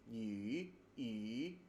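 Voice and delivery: yi said with rounded lips, like ü; the ü vowel, not plain u; umlaut u sound. Shown as a demonstration. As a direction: shape yi as the Mandarin ü. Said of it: "pronounce that U sound in a totally different way".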